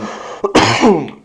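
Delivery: choking cough; sudden hard coughing; coughing fit. Real, not acted. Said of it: "are you good?".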